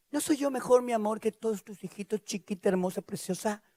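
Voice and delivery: husband sweet-talking his sad wife, softly mock-tender. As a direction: coo it like a husband trying to sound sweet. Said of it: "se quiso ver tierno".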